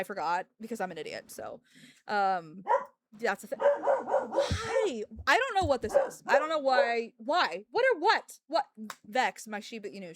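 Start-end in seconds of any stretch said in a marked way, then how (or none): 3.6-8.6 high, loud bewildered why; baffled outburst. Real, not acted. Streamer blurts at the bewildering news, loud and incredulous. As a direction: exclaim in disbelief, pitch jumping up.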